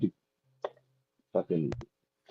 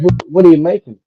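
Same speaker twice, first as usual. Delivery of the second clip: nosy butcher; 7 semitones higher, 7 dB louder than usual. Acted. Joke, butcher asks kid.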